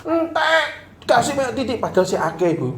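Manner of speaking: very high put-on complaining wife; hammed-up wife voice; mock complaint. Wife complains in acted quarrel.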